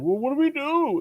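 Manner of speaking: high, put-on voice for a retold question; high-pitched mimicry. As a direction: pitch it way up as a mock quote. Voices a panicked character's question.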